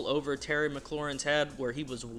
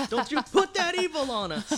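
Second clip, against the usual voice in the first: laughing; 9 semitones higher than usual. Real, not acted. Repeats protest while laughing.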